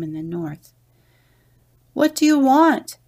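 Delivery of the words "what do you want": high, loud call across the sand. A narrator's reading. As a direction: call out to the distant goats. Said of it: "Hoshmakaka called out".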